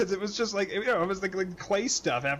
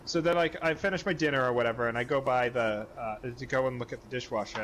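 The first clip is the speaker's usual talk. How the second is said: ordinary talk, nothing marked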